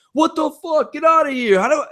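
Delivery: high-pitched mock holler; mock yelling. Storyteller mimicking players down the hall, high-pitched mock yell. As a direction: imitate an outraged yell from another room. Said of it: "this yelling's going back and forth".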